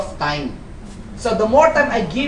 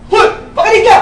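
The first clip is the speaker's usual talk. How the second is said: loud, high mock yell; mock shout; 11 semitones higher, 9 dB louder, faster than usual. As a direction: bark it at the stubborn one. Upline yelling at stubborn downline. (pasigaw na pagkukunwari)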